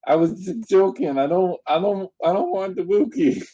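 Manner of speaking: high, flustered backpedalling; flustered protest. Real, not acted. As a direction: squeak out a panicked denial. Panicked backpedal after Wookiee taken.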